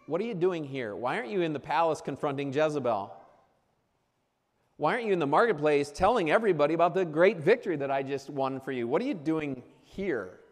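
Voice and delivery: a touch of God demanding answers; God's questioning. Acted. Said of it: "Was God asking".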